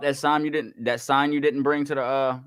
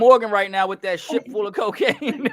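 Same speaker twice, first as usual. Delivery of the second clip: laughing while talking; 8 semitones higher, 5 dB louder than usual. Real, not acted.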